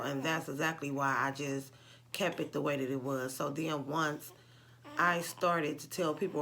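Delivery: ordinary talk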